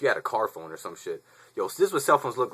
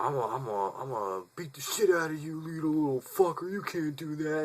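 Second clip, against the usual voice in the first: a higher, milder imitated rant; angry threats; 7 semitones higher than usual. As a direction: sputter threats, running out of steam. Driver keeps threatening.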